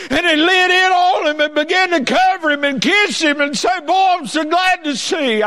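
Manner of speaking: high, loud revival preacher; full-force preaching. Acted. Preacher telling of the father running to his son, full-force preaching.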